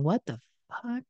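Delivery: soft, stunned disbelief; disbelieving what the fuck. Retold or imitated. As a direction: mutter it, staring in disbelief. Partner reacting to drunk spouse.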